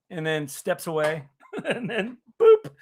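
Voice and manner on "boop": a sudden squeaky high-pitched boop, short and loud. Retold or imitated. Friend mimics the chat bot's high boop. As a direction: chirp it out like a cartoon beep. Imitating the ear trumpet bot.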